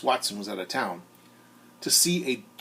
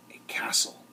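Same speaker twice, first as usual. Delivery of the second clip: soft whispered reveal; whispered wonder at the castle; 4 dB quieter than usual. A narrator's reading. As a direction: breathe it like a secret. Reveal of the box's use.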